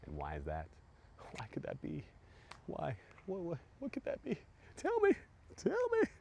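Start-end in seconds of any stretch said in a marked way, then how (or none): 1.4-6.1 squeaky pleading tell me, tell me; mock squeal. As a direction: tease them higher and higher. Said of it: "teasing the audience".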